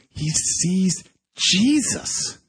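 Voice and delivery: high, slow, drawn-out amazement; awed revelation. Retold or imitated. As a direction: act out a stunning realisation, slowly. Prisoner's astonished revelation acted out.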